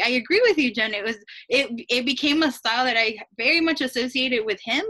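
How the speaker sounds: normal talking voice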